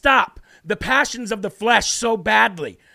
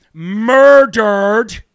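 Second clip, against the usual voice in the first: MURDERED stretched out very slow and loud; drawn-out emphasis; 10 dB louder than usual. Real, not acted. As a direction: hammer "murdered", dragging out both syllables loudly. Outraged emphatic repetition of murdered.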